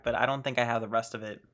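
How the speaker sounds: ordinary talk, nothing marked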